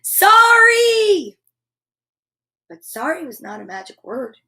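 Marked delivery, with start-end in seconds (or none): 0.0-1.4 high, loud, drawn-out yell; yelled apology. A narrator's reading. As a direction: yell it out on a huge breath. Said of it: "took a huge breath, and yelled".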